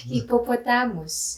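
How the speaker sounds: Hippopotamus with pure o vowels; Filipino accent. Shown as a demonstration. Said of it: "I will say the Filipino accent for it".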